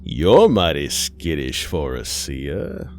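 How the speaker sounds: slow, drawn-out taunt with swooping pitch; taunting otherworldly being. Acted. Thing in mother's face mocks Aisha, slow taunting. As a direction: toy with your frightened prey, slowly.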